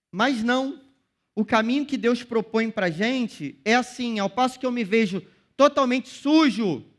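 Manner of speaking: rising pulpit fervour; fervent preaching. Acted. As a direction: preach it to the whole church at full force.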